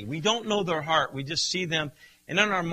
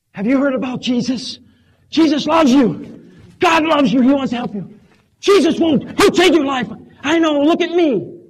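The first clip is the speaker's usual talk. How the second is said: high, loud, pushy witnessing; zealous street preaching; 9 semitones higher, 12 dB louder than usual. Acted. Preacher acting out anxious evangelizing at a passerby, loud and pushy. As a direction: hammer the gospel at a stranger.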